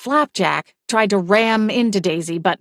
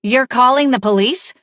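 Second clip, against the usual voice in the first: higher, louder, disbelieving accusation; furious outraged question; 5 semitones higher, 4 dB louder than usual. Acted. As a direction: catch her out with rage. Furious discovery before lunging.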